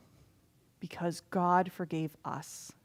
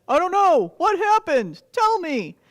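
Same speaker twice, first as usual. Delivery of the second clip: eager king; 8 semitones higher, 9 dB louder than usual. Acted.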